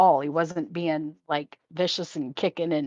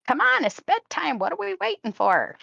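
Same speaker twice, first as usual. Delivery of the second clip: high eager kitten voice, louder; excited cat voice; 12 semitones higher, 4 dB louder than usual. Retold or imitated. Kitten urging its owner to bed, high and eager. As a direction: bounce in like an impatient kitten. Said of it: "jumped up like".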